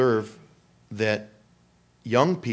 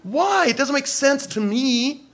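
protesting youth; 10 semitones higher than usual. Retold or imitated. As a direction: squeak out the why.

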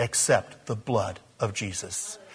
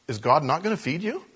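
ordinary talk, nothing marked

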